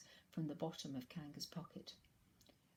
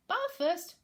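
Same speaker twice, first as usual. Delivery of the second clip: high, loud cheerful mother; 13 semitones higher, 10 dB louder than usual. Acted. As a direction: brightly announce bath time. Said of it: "in a cheerful voice".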